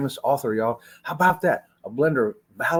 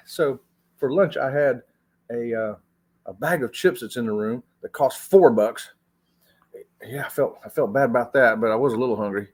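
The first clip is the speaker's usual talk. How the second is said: ordinary talk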